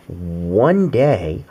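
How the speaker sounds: ordinary delivery, nothing marked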